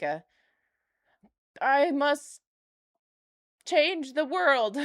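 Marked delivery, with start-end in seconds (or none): 1.6-4.8 mock heroic, louder and slow; grandiose mock voice. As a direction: puff it up like a self-important crusader.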